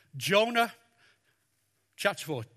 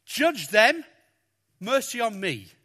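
mock demanding Jonah; 3 semitones higher, 7 dB louder than usual. Mock quote of Jonah's wish.

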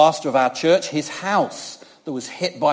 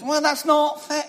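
put-on childish whine; 11 semitones higher than usual. Child's retort voiced.

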